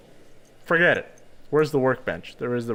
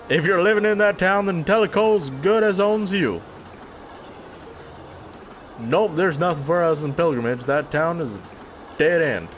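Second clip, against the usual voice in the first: put-on southern accent; 4 semitones higher than usual. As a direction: warn him off in a hammed-up Southern twang. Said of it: "I had to stay in that shitty accent".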